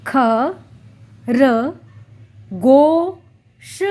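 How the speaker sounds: ख, र, long गो, श; syllable by syllable. Shown as a demonstration. Teacher sounding out खरगोश. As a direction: break खरगोश into ख, र, गो, श. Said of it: "खरगोश कैसे लिखते हैं?".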